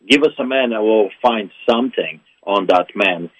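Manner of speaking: ordinary talk, nothing marked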